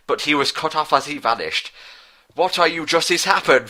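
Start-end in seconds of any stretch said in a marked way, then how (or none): 2.4-3.7 cried out. Acted. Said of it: "Falcon cried".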